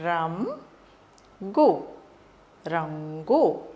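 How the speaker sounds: రంగు split రం గు, then said whole slowly; syllable by syllable. Shown as a demonstration. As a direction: sound it out for children. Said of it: "రంగుని ఎలా పలకాలో చెప్తాను". (అక్షరాలుగా విడదీసి నెమ్మదిగా)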